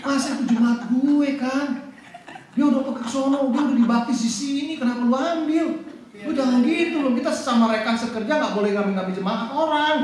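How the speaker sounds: high-pitched, hammed-up complaint; mock-protest like a pastor robbed of his flock; indignant pastor's protest. Pendeta protes jemaatnya diambil. (protes pendeta yang dibuat-buat, nada tinggi)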